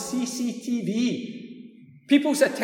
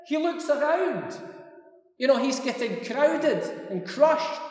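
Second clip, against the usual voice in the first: usual speaking voice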